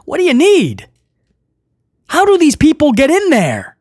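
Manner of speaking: pitched well up in mild exasperation; incredulous rant, rising high then dropping; exasperated. Real, not acted. Podcaster ranting about the reporter, exasperated.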